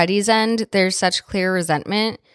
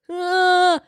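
high, taunting fake screams; mocking scream; 10 semitones higher than usual. Podcaster imitating Buffalo Bill's mocking screams, high and taunting. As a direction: yelp mockingly like a captor teasing his victim. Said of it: "The mocking, screaming sounds".

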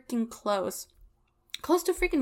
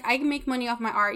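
usual speaking voice

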